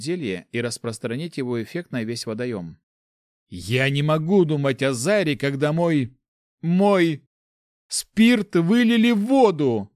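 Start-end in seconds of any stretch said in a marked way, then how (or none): 3.5-9.9 mounting wrath, ending high and loud; halting outrage building to a drawn-out cry; angry. A narrator's reading. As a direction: rage over a precious loss, stumbling then bursting out. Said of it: "с гневом произнёс паладин".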